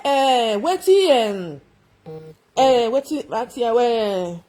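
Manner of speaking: lightly mimicking an Edo speaker, slow; a mild imitation of an Edo voice, drawn out. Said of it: "eh, Edo voice".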